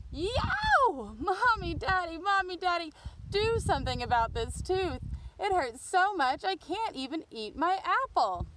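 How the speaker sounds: put-on a boy howling for his parents; yelling. Boy yelling to parents about aching tooth, high wail. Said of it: "and yelled".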